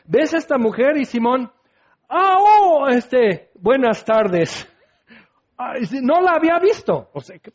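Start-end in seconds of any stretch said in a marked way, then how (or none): 2.1-4.7 caught-out host, starting much higher and louder, then slowing down; a flustered, fake-polite greeting; awkward feigned surprise. Simón feigning surprise at the woman. 5.6-7.1 mock innocence. Simón pretending he hadn't noticed.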